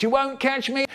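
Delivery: high-pitched taunting shout. A narrator's reading. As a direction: taunt your pursuer as you escape. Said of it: "she shouted".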